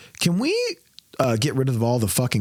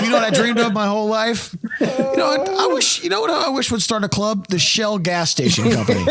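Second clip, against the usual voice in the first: laughing mockery; 9 semitones higher than usual. Real, not acted. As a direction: giggle through a sarcastic dream. Laughing mock dream rant.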